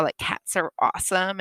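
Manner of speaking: ordinary delivery, nothing marked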